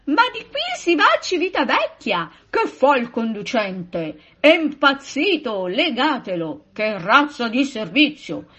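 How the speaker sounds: loud, high indignant outcries; acted angry complaints, starting high; stormy protest. A narrator's reading. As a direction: protest like an outraged crowd of passengers. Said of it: "le proteste diventarono un coro tempestoso".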